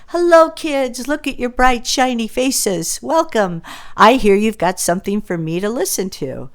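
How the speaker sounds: put-on high, loud, booming principal greeting kids; Principal Brown. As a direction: play the jolly principal beaming at the class. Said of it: "In comes Principal Brown".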